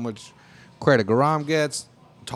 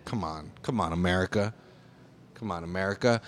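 weary plea; 6 semitones lower, 7 dB quieter than usual. Real, not acted. Pleading with the country.

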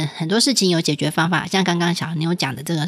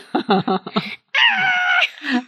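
high shrieking laugh. Real, not acted. Squeaky toy shriek.

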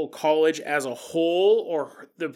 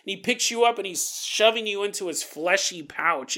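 normal talking voice

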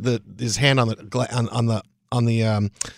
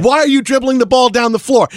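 higher and louder, worked up about the play; animated; 10 semitones higher, 4 dB louder than usual. Retold or imitated. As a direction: get fired up arguing the play. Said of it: "he's getting really animated".

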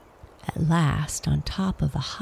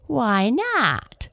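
higher, dismissive sniff; 7 semitones higher, 3 dB louder than usual. A narrator's reading. Long Nose to Astrid, sniffing and dismissive. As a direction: brush it off with disdain. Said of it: "sniffed Long Nose".

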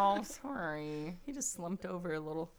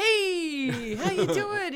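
casual guy voice; 8 semitones higher, 9 dB louder, much slower than usual. Retold or imitated. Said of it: "he goes".

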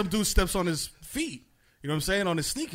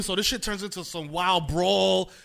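ordinary delivery

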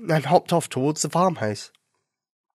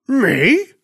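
loud, high, startled question; startled dog; 10 semitones higher, 6 dB louder than usual. A narrator's reading. Farm dog hearing he is wanted, startled. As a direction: yelp it as a question, taken aback.

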